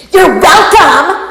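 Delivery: high, loud, slow, mocking sing-song; obnoxious you're welcome. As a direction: drag it out with fake sweetness. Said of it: "really obnoxious face and voice and tone".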